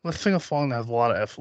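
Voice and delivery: lisping cartoon-duck delivery; Daffy Duck lisp. Host proposing a silly song, Daffy Duck lisp. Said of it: "Let's Daffy Duck this shit".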